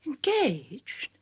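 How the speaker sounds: shocked echo, high then falling. Acted. Mother hearing of her son's engagement, shocked. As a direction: repeat it in appalled disbelief.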